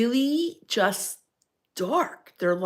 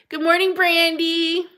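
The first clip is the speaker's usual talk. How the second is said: cheery greeting; 9 semitones higher, 4 dB louder than usual. Real, not acted. Greeting a viewer by name.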